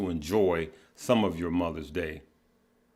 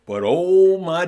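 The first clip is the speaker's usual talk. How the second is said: a sudden, much higher and louder "oh"; emphatic exclamation; 9 semitones higher, 8 dB louder, slower than usual. Real, not acted. Telling viewers his dad could whoop, emphatic burst. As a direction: burst out before the punchline.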